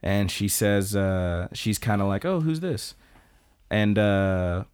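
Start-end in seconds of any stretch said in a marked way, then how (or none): 2.2-2.9 lightly voiced mother. Voicing frail mother greeting a stranger.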